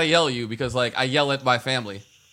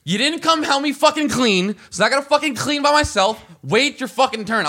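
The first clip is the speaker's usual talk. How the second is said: retold angry outburst, pitched way up and loud; spazzing out; 10 semitones higher, 4 dB louder than usual. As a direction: snap at him like you've lost it. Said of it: "Then I just spaz".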